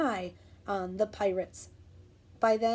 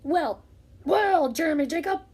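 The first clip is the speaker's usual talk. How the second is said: loud high pirate cry; pirate shocked at the wrong beach; pirate exclaim; 5 semitones higher, 5 dB louder than usual. Acted. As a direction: shout it like a startled captain. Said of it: "he said".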